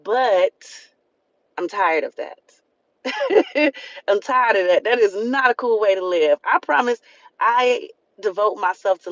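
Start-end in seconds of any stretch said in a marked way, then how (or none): 3.0-4.0 real laugh, pitched high; short high laugh. Real, not acted. Laughing at people pleasing.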